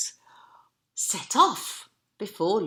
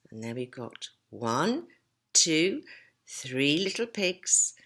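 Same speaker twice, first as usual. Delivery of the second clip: plain reading voice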